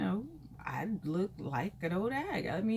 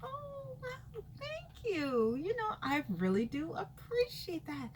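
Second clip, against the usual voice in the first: much higher, soft and slow, a put-on voice; mock flattered reply; 12 semitones higher, 4 dB quieter, slower than usual. Acting out her reply to the stranger, much higher and coy.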